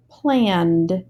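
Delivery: Planned ending in a clear d sound. Shown as a demonstration. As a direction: say Planned with a final d. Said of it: "I'm going to say plan with this ending".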